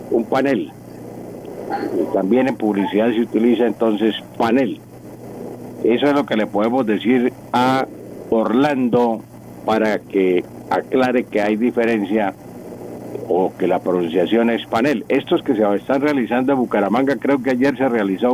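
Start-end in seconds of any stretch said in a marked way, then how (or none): none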